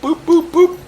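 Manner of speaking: high, evenly spaced boops; mimicked skip sound. Host mimics skipping ahead through the recording, high boops. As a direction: make little high-pitched button noises.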